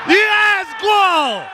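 loud high goal yell; excited goal shout. Real, not acted. Goal scored, commentator yells.

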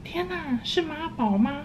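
high, soft mocking gasp; thinking it about the roommate, high and softly mocking; mock disbelief. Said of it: "我想说".

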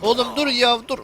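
sharp, high, loud shout of stop; startled yell. Real, not acted. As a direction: yell at him to stop right now. Yelling at attacker in game.

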